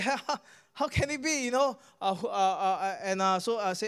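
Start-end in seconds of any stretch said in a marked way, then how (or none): 0.0-1.8 high-pitched, stammered disbelief; shocked. Retold or imitated. Healed man reacting to his restored hearing, shocked. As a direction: act out a man who can't believe it. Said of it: "He was shocked".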